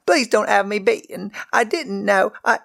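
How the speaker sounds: high, frightened begging; a child afraid of a beating; pleading girl. A narrator's reading. Servant girl pleads not to be beaten, high and scared. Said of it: "Megan cried".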